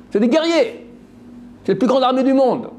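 high, emphatic build on the warriors; rising emphasis. Real, not acted. Preacher exalting the strength of the West to his listeners. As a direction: lift the voice to stress their might. Preacher stressing Esav's might.

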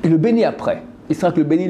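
ordinary talk

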